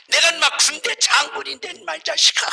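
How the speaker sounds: mock angry general dad, high-pitched; high, indignant retold scolding; scolding father. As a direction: imitate a father telling off his son. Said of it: "하면서 혼내".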